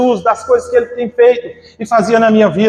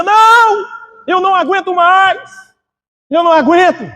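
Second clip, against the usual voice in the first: crying out from the soul; 9 semitones higher than usual. Retold or imitated. Regiane overwhelmed in the sermon, crying out. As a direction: pour your whole soul into the cry. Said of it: "ela gritava, irmão, com a alma".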